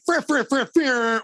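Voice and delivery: very high horn honks of fur; opening the show with a horn call, high and loud; horn impression. Shown as a demonstration. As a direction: honk it out like a horn. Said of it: "Oh, that was good".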